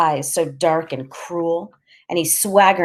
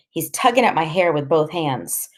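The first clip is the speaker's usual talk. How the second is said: ordinary delivery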